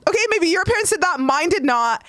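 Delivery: very high, fast, indignant; indignant kid. Retold or imitated. Child hurling it back at classmates, high and indignant. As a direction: throw it back at them. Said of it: "I was so mad".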